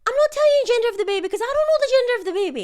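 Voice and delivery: high, fast, loud protest; exasperated wife arguing her point in a fast high voice; mock exasperated. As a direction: rattle it off, exasperated. Retold argument, own side.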